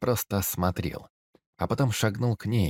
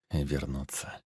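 soft, lower whisper to himself; 5 semitones lower, 6 dB quieter, slower than usual. A narrator's reading. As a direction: murmur it under the breath. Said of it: "шепнул он самому себе".

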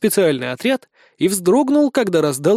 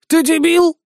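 high, loud creature outburst; indignant snail; 10 semitones higher, 5 dB louder, slower than usual. A narrator's reading. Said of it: "возмутилась улитка". (возмущённая улитка)

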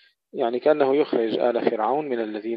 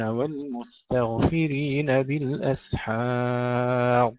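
slow, louder, drawn-out chanted recitation; Quran recitation; 4 semitones higher, 7 dB louder, much slower than usual. Acted. Reciter chanting the verses to his students in a slow tajweed style. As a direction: intone each verse slowly and resonantly.

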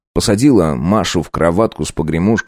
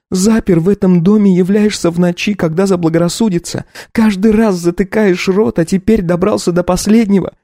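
Katya, furious wife; 12 semitones higher than usual. Acted. Wife accuses her husband of taking everything. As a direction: hurl the accusations at him.

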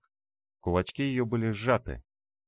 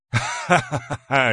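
громкий протяжный демонический смех; расхохочись медленно и громко; злодейский хохот; 7 semitones higher, 9 dB louder, much slower than usual. Acted. Демон насмехается над героем. (раскатистый злорадный хохот)

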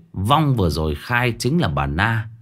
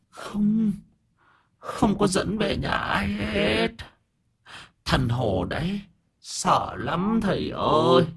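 pleading ghost; 10 semitones higher than usual. A narrator's reading. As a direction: beg like a frightened ghost in a borrowed body.

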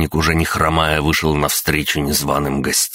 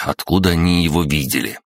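usual speaking voice

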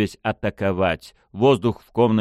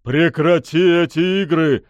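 loud, high, angry bark; barked order; 9 semitones higher, 6 dB louder than usual. A narrator's reading. Pushkin orders the unseen lady to stop playing, barked. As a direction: roar the command at a hidden foe. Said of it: "рявкнул он".

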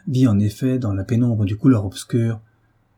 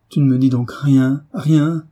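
loud outcry, climbing higher on the last word; anguished cry; 4 semitones higher, 5 dB louder than usual. A narrator's reading. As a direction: cry out in frightening pain. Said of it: "s'écria David, effrayant de douleur". (cri de douleur angoissé)

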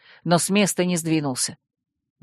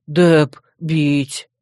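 slow, heavy growling brute; brute's threat; 3 semitones lower than usual. A narrator's reading. Dep obeys Joe's wish, brutish growl.

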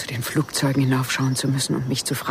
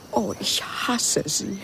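anguished; 5 semitones higher, much slower than usual. Acted. Jealous outburst.

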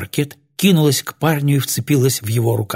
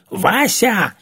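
high-pitched indignant call of a name; indignant reproach; 9 semitones higher than usual. A narrator's reading. Two women exclaim at Вася at once, indignant and reproachful. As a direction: scold him by name, pitched up. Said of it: "смешались негодование и упрёк".

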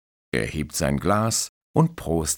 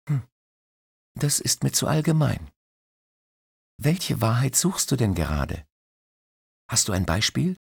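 put-on curious young woman; 8 semitones higher than usual. Christine voiced higher.

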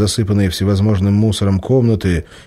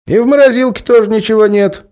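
put-on high, loud young voice calling from behind; a young guy catching a thief; young man's voice; 6 dB louder than usual. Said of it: "раздался сзади молодой голос".

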